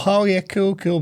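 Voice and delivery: mock easygoing yes; forced casual agreement. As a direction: play it cool, too eagerly. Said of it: "doing the whole".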